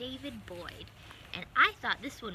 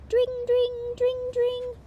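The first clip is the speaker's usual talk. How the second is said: much higher, louder sing-song ding of a bell; bicycle bell; 13 dB louder, much slower than usual. Acted. Mice ringing bicycle bells on the way, sung bell sound. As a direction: ring it out like a little bike bell.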